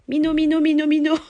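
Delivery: « minot » répété, plus aigu et fort, en riant; Marseille accent. Shown as a demonstration. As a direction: chantonne « minot » avec l'accent du Sud. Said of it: "un minot, que j'ai dit". (accent marseillais en riant)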